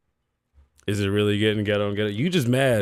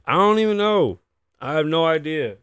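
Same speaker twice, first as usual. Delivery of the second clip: high, loud, flustered outburst; real flustered exasperation; exasperated; 6 semitones higher, 5 dB louder than usual. Real, not acted. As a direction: throw it out high and loud, totally at a loss. Flustered by technical trouble on show.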